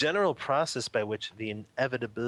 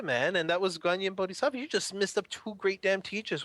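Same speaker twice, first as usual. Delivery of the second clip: high-pitched, acted telling-off; incredulous old man's rebuke, pitched up; exasperated scolding; 8 semitones higher than usual. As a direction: scold the foolish student, voice held high. Old man scolds monk in story.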